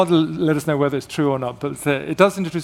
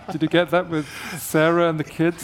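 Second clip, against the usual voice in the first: normal talking voice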